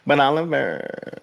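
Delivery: put-on crying voice, stretched out; crying naysayer voice. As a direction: wail it like a crybaby doubter. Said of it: "Do the crying voice".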